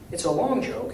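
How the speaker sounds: usual speaking voice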